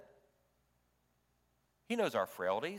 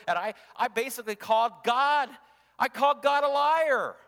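higher and louder, crying out in shame; anguished confession; 7 semitones higher, 6 dB louder than usual. Acted. Peter confesses denying Jesus, anguished.

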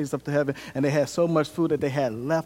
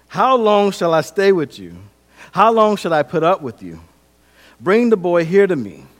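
loud, raised, exasperated command; frustrated rebuke; 5 semitones higher, 8 dB louder than usual. Acted. Jesus scolds his disciples, loud frustrated rebuke. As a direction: voice an upset Jesus losing patience. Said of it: "He is frustrated".

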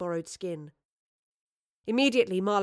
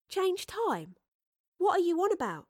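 put-on high, incredulous girl's voice; baffled Astrid; 11 semitones higher than usual. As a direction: ask it high, utterly puzzled.

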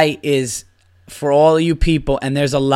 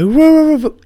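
high, loud mock dog woofing; imitated dog attack noise; 7 dB louder than usual. Storyteller imitating Angel launching at a little dog, loud woofing. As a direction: woof like a dog going berserk.

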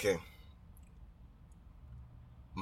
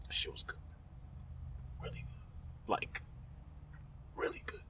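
whispered praise; 16 dB quieter than usual. Real, not acted. Savoring the edibles.

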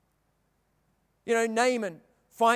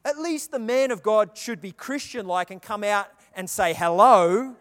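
normal talking voice

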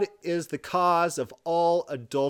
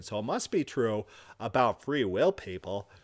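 ordinary talk, nothing marked

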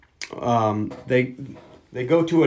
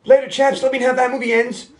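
Murdoch yelling; 9 semitones higher than usual. Retold or imitated. Murdoch yelling goodbye as he jumps in. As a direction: yell it out in a goofy high voice. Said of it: "Murdoch's yelling".

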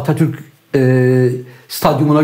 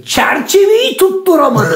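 much higher, slow put-on voice; mock incredulous voice; 14 semitones higher, slower than usual. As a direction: ham up the disbelief. Mocking a missed shot.

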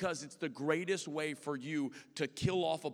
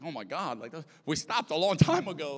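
exclaimed in comic dismay, louder toward the end; mock alarm; 3 semitones higher, 9 dB louder than usual. Pastor answering someone still fasting months later, mock alarm. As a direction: burst out in exaggerated disbelief.

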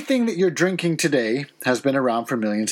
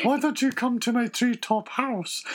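high-pitched Scottish owl invitation; lilting Scottish owl voice; 7 semitones higher than usual. Acted. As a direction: coax in a high Scottish accent. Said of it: "the owl was as Scottish".

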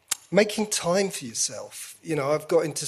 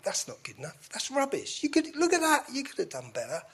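higher, sneering critic voice; inner critic voice; 9 semitones higher, 4 dB quieter, faster than usual. Retold or imitated. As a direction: play the nagging parrot in his head. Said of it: "this parrot pops up".

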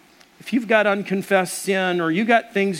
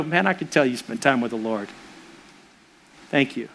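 grateful wife; 7 semitones lower, 4 dB quieter than usual. Retold or imitated. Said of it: "She'd go".

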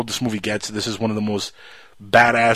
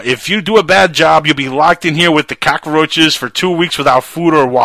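louder mock threat; louder hammed-up threat; sly kid threat; 5 semitones higher, 7 dB louder than usual. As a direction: warn them like a tiny tyrant. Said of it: "when he said".

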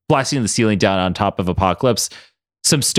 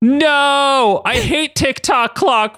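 screaming; 14 semitones higher, much slower than usual. Acted. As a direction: howl it while falling in. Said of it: "[name] screamed".

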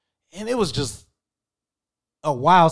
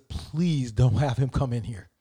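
slow, softer pleading; silent prayer; 5 semitones lower, 5 dB quieter, slower than usual. Retold or imitated. Hiding in the closet, softly praying. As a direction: beg under your breath. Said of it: "all I can think is".

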